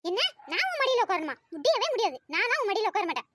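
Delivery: indignant refusal. Acted. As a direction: refuse outright, offended. Girl refuses to sit on lap. (கோபமாக மறுப்பது)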